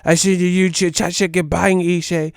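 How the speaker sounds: loud mock British fan voice. UK fans praising Ishay's YouTube, loud mock British cheer. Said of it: "They be like".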